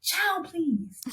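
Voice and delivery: a teasing, affectionate scoff; sassy dismissal. Retold or imitated. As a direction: brush off their fear with a knowing sass. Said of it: "And I'm like".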